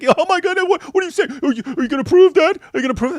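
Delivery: imitating Andrew's mock-horrified protest, high and loud; mock outrage. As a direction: overplay the shock, pitch way up. Mocking Andrew's outraged reaction.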